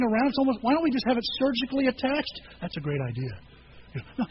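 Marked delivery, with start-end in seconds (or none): 2.6-4.3 much lower, softer and slow; deadpan the idea as absurd; dry sarcasm. Real, not acted. Pastor mocking his own joke to the congregation, deadpan. Sarcastic aside on attached phones.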